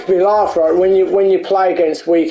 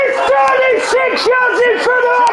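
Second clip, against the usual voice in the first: furious high yelling; angry shout; 14 semitones higher than usual. Real, not acted. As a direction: scream it in outrage. Player raging at game offside.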